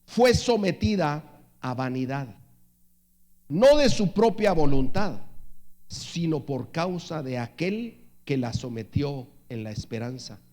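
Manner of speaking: deliberate reading, swelling and falling by phrase; slow scripture reading. Real, not acted. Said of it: "lo voy a leer despacio". (lectura bíblica pausada, con énfasis que sube y baja)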